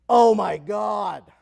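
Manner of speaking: loud, slow stunned cry; shocked outburst. Retold or imitated. Lady discovering her values, loud stunned cry. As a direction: let it burst out in shock. Lady's outburst during exercise.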